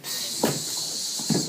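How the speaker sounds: long, soft hiss of escaping smoke. Retold or imitated. Storyteller imitating the smoking time machine, hissing smoke. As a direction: imitate steam leaking out, drawn out. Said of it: "it's got smoke coming out".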